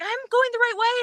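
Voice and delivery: high, rising excited cry; excited call. Retold or imitated. Friend calling back to runner, high excited call.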